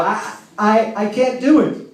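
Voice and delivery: hammed-up little boy whine; imitating a wail. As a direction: mimic a kid wailing in frustration. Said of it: "he starts to wail".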